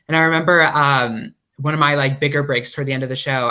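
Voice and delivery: ordinary talk, nothing marked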